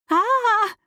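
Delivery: high, loud scream. A narrator's reading. Driver screams at the talking cat, high scream. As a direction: scream out in shock. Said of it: "and then I screamed".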